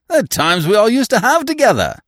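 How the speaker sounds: high, loud, gushing voice; jovial old-pal gusto; exuberant reminiscing. A narrator's reading. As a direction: insist heartily on the good old times. Jovial stranger insists on shared past.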